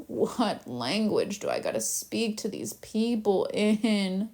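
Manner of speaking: slow, exasperated groaning delivery; frustrated God. Acted. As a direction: drag it out like a God fed up with people. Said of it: "And God's like".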